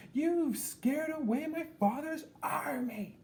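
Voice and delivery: high, slow, indignant princess voice; haughty princess. A narrator's reading. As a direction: scold him like a spoiled royal. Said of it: "said the princess".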